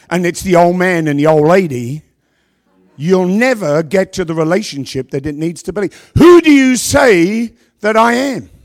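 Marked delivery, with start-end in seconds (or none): 6.2-8.5 "who" loud and high, slow; slow loud preaching. Real, not acted. Preacher challenging the congregation, slow and loud. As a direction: drive the question home slowly.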